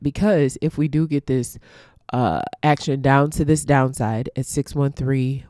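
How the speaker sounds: ordinary talk, nothing marked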